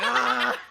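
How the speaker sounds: loud high-pitched scream; reenacted panicked shriek; mock scream. Streamer showing co-host how he screamed on the ship, shrieking. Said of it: "having Sarah levels of screaming there".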